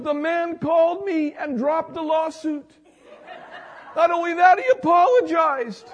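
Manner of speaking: laughing with joy. Retold or imitated. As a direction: gush through laughter. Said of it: "She goes".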